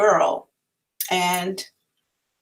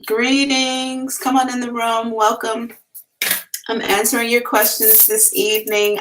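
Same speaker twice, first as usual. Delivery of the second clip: sing-song greeting; 7 semitones higher than usual. Acted.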